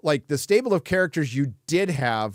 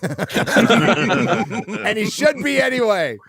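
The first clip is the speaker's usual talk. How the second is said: laughing; 8 semitones higher, 6 dB louder, much slower than usual. Real, not acted.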